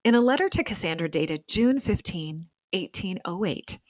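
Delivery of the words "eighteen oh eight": ordinary talk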